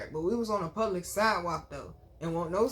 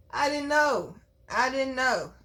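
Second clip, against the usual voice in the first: higher, louder insistence; loud, pitched-up I didn't know; emphatic; 6 semitones higher, 5 dB louder, slower than usual. Real, not acted. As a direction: drive home that she had no idea. Stressing she was fooled.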